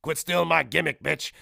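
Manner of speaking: mock indignant outburst; Call of Duty. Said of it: "then Call of Duty goes".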